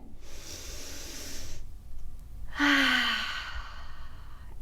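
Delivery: long, soft breathy sigh letting tension go; big sigh out. Shown as a demonstration. Teacher showing kids how to let anger out, long sigh. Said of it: "We breathe our shoulders up".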